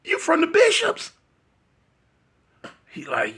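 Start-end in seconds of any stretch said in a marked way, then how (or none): 0.0-1.1 high, rising disbelief; shocked realization. Retold or imitated. To Peanut, realizing he joined the Bishops, high shocked disbelief. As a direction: stammer into a high shocked question.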